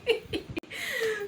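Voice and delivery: high, breathy laugh half crying; teary laugh. Real, not acted. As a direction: laugh through the tears. Laughing at the memory.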